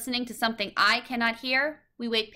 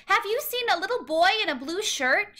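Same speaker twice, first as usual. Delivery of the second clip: anxious cry; 9 semitones higher than usual. A narrator's reading. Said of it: "she cries".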